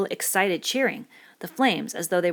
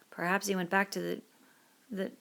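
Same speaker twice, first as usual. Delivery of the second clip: muttered, softer, ashamed; muttered in shame; 5 dB quieter than usual. Acted. Ralph answering Piggy, muttered in shame. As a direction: trail off ashamed. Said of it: "Ralph muttered the reply as if in shame".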